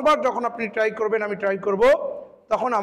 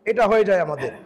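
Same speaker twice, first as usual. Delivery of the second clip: ordinary talk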